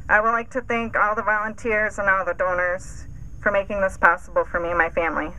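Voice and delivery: ordinary talk, nothing marked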